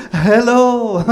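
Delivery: high, hammed-up Korean-accented greeting; thick Korean accent. [name] greeting the host couple, Korean accent poured on thick. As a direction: pour on a mock Korean accent. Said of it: "pours it on so thick".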